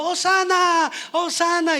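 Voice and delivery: high, loud ecstatic shout. Retold or imitated. Donkey owner in the crowd shouting praise, full high shout. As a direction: cry it out with all your joy.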